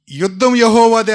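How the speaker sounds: high, loud battle cry; loud proclamation. Shown as a demonstration. Preacher cries the battle slogan to the church, loudly. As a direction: proclaim it with full conviction.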